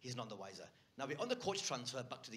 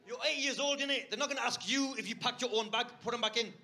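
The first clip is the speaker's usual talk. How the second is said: put-on higher and louder, scolding the kid; exasperated telling-off; 8 semitones higher, 7 dB louder than usual. Uncle scolds the eight-year-old over the bag, loud and exasperated. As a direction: raise your voice at a stubborn child.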